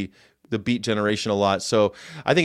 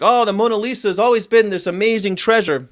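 mock gushing fan; 8 semitones higher, 3 dB louder than usual. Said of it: "People like".

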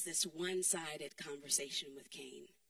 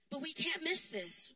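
ordinary talk, nothing marked

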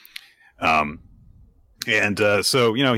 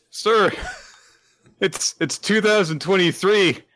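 mock exasperated; 12 semitones higher than usual. Stagehands scolding in mock voice.

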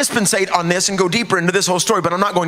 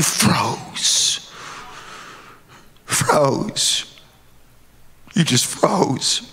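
slow, breathy-soft repetition; stunned quiet; 1 hushed awe; 3 dB quieter, much slower than usual. Acted. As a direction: let it fall to a hush.

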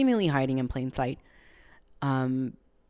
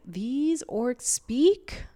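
slow, drawn-out high disbelief; mock amazement; 8 semitones higher, much slower than usual. Viewer marveling that the orcs can speak, slow amazed. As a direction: stretch it out like you can't believe orcs talk. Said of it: "I'm just like".

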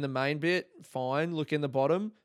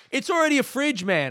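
high, loud protest; exasperated mock outburst; 12 semitones higher, 7 dB louder than usual. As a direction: burst out in pretend exasperation. Imagined exasperated retort to customer.

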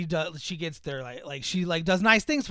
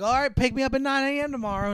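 higher, hopeful reminder; trusting daughter; 9 semitones higher, 3 dB louder than usual. Retold or imitated. Daughter setting the pickup time, high hopeful. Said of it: "she's like".

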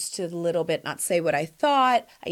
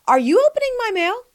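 high, loud, pointed question; role-play confrontation; 14 semitones higher, 7 dB louder than usual. Acted. Host plays confronting a mail-opening friend, pointed call-out. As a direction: call out the snooper, pitch shooting up. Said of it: "Role play".

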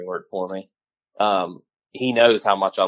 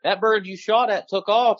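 quoting Caleb a lot higher, half amused; buddy's raised voice; 10 semitones higher than usual. Retold or imitated. Retelling a hunting buddy's words to friends, raised pitch. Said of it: "He said".

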